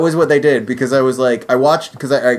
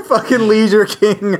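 laughing; 4 semitones higher than usual. Real, not acted. Laughing with friends at the character's name, amused laughter. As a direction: chuckle it out, savoring the name.

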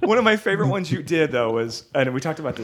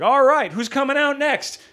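announcer voice; 9 semitones higher, slower than usual. Retold or imitated. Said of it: "and then it's like".

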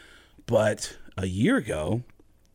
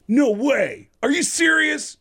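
high, loud, thrilled disbelief; astonished excitement; 11 semitones higher, 6 dB louder than usual. Retold or imitated. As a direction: stop him short, bursting with amazement. Singer astonished by the prank reveal.

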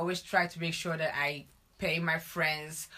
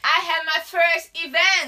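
excited; 9 semitones higher, 9 dB louder than usual. Real, not acted. Announces her first event proudly.

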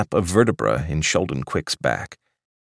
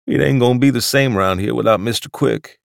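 put-on much higher, gentle old waiter voice; a doddering old waiter offering more; sweet old waiter; 6 semitones higher, 5 dB louder than usual. Waiter mourning the departure.

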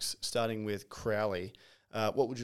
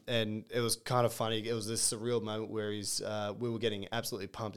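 ordinary talk, nothing marked

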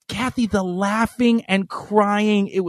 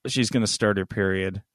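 much lower and softer, to yourself; private thought; 12 semitones lower, 6 dB quieter than usual. Retold or imitated. Boyfriend noticing the signs, a quiet inner thought. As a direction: think it quietly under your breath. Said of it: "you're kinda like".

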